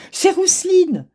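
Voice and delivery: high, loud surprised cry; excited cry. A narrator's reading. Mother cat spotting her daughter on TV, high surprised cry. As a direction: call out in sudden delight. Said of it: "s'écria madame Caroline".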